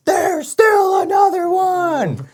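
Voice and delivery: high, stretched-out holler; re-enacted full-voice warning call; long-distance yell. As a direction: shout it slowly across a wide valley. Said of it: "So I'm yelling".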